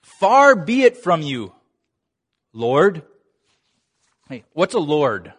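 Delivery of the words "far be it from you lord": emphatic protest. Retold or imitated. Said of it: "Look at there".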